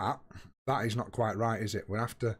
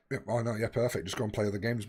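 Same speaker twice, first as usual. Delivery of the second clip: ordinary talk, nothing marked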